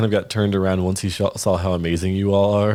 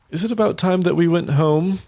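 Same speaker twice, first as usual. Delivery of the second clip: high hopeful question; Estelar suggesting; 9 semitones higher than usual. Acted. Father hopefully suggesting the family go home, high voice. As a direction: nudge them homeward. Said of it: "Estelar is like".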